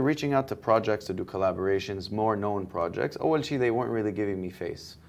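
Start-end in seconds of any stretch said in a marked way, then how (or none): none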